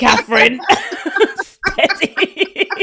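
high, laughing warning. Real, not acted. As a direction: giggle through the warning.

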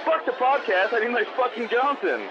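usual speaking voice

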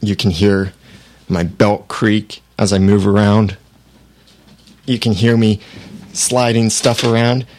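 distant, roomy, echoing off the walls; hollow room sound from eighteen inches away; far from the mic. Shown as a demonstration. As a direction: step back from the mic with the gain cranked up. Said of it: "It sounds like I am in a room somewhere".

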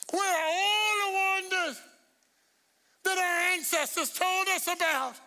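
high, drawn-out pulpit cry; preaching shout. Shown as a demonstration. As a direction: cry the verse out to the congregation. Verse repeated as preaching climax.

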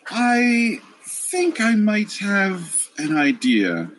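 high, slow, serene deity voice; goddess voice. Acted. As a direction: speak as a calm goddess.

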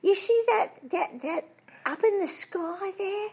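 high toff voice breaking into laughter; Mister Twit. Retold or imitated. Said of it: "And he says".

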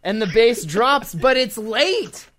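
high, loud indignant rant; hammed-up party disaster; mock outrage. Mock outrage at late bass drop.

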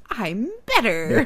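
long drawn-out mock kid announcement; slow, exaggerated mimicking of a child; recovered kid. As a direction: imitate a kid, stretching the words slowly. Voicing kid announcing his recovery.

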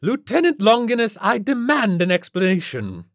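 high, imperious society-lady demand; loud, haughty outrage; almost too loud. A narrator's reading. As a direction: demand it like an affronted rich matron. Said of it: "stopped just short of being too loud".

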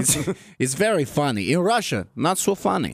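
a light put-on Russian accent; deadpan comic Russian accent; mock Russian accent. Lev joking about Russian humour in mock Russian accent. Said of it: "my Russian accent".

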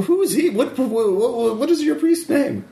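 flustered priest; confused priest. Acted.

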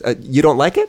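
ordinary delivery

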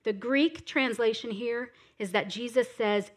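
ordinary talk

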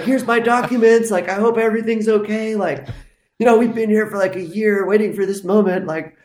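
high, loud, nervous rush of pleading; anxious pleading self. Retold or imitated. Nervous applicant pleading with police over her documents, high and anxious. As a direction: sound like you're begging them to approve it. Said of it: "I was like".

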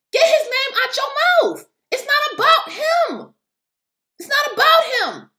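upset. Real, not acted. Telling critics it's not about Trump, upset. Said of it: "I'm getting upset".

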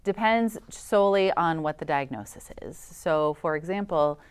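ordinary talk, nothing marked

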